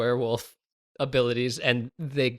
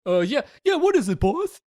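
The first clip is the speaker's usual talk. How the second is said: high goofy henchman voice; 11 semitones higher, faster than usual. Acted. Henchman character voice.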